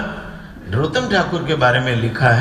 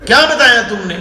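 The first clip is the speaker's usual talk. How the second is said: high, loud imitated scolding; retold outburst, pitched up; angry guru; 8 semitones higher, 6 dB louder, faster than usual. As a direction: mimic an elder's cross interrogation. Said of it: "महाराज इतना गुस्सा हो गए".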